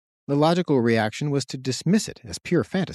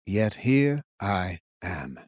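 slow, grave royal voice; a lightly voiced ancient king, measured; solemn old king; 4 semitones lower, much slower than usual. A narrator's reading. King's voice in read story.

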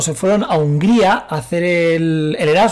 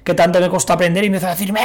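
normal talking voice

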